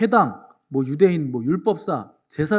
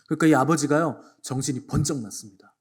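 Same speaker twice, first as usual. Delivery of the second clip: ordinary delivery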